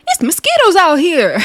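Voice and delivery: high-pitched imitated holler; mock yelling. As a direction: yell it out in comic imitation. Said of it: "the way she was yelling".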